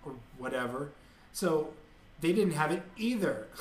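mock Kardashian talk. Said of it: "people emulate the way that they speak".

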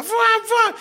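high-pitched "fuá" whooshes; imitated passing cars. Driver on the highway, high whooshing car noises. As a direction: mimic cars zooming past you.

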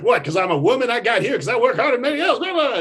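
indignant rant. Retold or imitated. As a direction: rattle it off, offended and defensive. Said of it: "She's like".

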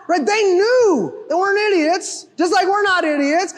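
ordinary talk, nothing marked

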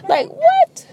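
a sudden high yelp of surprise; stunned what. Real, not acted. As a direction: shoot up in pitch, caught off guard. Disbelief at mom's words.

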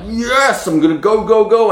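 high, rising eager rallying cry; half-mock hyped cheer; excited cheer. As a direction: pump yourself up to dive in. Pumped self-talk quote.

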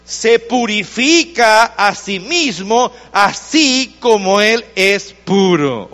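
"se purifica a sí mismo" drawn out slowly, each word weighed; slow emphatic. Shown as a demonstration. Pastor driving the verse home to his church, slow emphatic preaching. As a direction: stretch the verse out slowly and let it land. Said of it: "Todo aquel que tiene esta esperanza".

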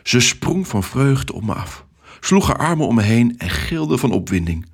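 ordinary delivery

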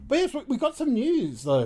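excited. Real, not acted. Excited lead-in to news.

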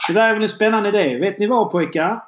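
upbeat, lifted presenter voice; radio host sign-off. Acted. Host sending listeners to the break, upbeat announcer sign-off. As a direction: punch up the segment outro, rising to a big finish.

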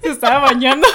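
laughing repeat. Retold or imitated. Repeating her excuse to the listener, laughing. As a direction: laugh at your own lame excuse.

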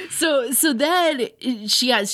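ordinary talk

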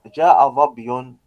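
slow, emphatic جاء ظبي; slow clear demo. Shown as a demonstration. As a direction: say each word clearly. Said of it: "الضمة تظهر".

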